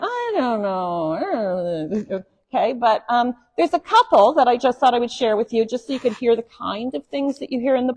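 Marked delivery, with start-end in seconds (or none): 0.0-2.2 very slow, drawn-out lower baffled reply; puzzled shrug. Retold or imitated. Preacher imitating herself stumped by Bible references, slow and baffled. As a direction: stretch it out, stumped by the texts.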